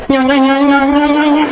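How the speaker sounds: sputtering engine noise made with the voice; imitated car starter. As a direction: mimic a car that won't start. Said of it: "Uno empieza". (imitando el arranque del carro)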